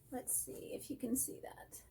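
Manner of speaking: soft whisper to herself; whispered aside while holding up the stitching. Real, not acted. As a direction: breathe it under your voice while checking.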